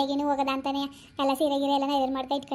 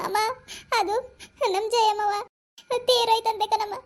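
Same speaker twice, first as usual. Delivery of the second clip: higher, tearful voice breaking the news; 7 semitones higher than usual. Acted. Roja tells her mother of Jayamma's death, tearful. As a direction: choke out the news through tears. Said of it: "ಯಾಕೆ ಅಳ್ತಿದಿ?".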